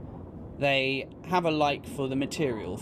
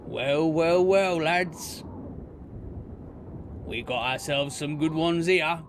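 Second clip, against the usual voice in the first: rough, louder orc bandit voice; higher, louder menacing orc; orc leader; 4 semitones higher, 3 dB louder than usual. Acted. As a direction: swagger it like a gloating orc. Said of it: "and he says".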